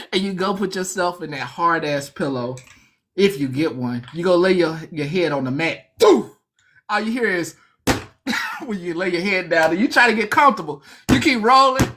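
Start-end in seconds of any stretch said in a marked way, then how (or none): 6.0-6.3 loud high mock thud; thud sound effect. Head hitting the hard mat, a loud mock thud. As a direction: slam out the thud noise.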